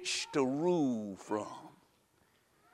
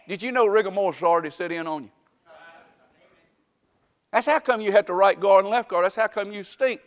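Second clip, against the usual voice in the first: usual speaking voice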